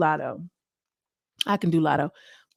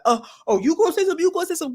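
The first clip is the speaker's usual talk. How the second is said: mock guards; 10 semitones higher, much faster than usual. Imitating guards' face.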